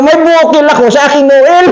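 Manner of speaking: high, laughing delivery; punchline said through laughter. Real, not acted. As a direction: crack up while saying it.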